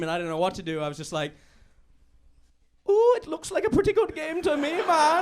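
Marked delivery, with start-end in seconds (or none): 2.9-5.2 put-on high, lilting Indian accent, a little louder. Pub fan hiding he's English, mock Indian accent. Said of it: "we didn't know you were Indian".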